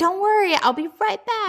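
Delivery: high, soft imitation of Alice; high voiced Alice. Alice reassuring her fiancé, high and soft. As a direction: squeak it out sweetly, brushing him off.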